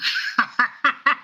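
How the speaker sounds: high breathy burst of laughter; real giggling fit; laughing. Real, not acted. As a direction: crack up helplessly. Game master reacts to the joke.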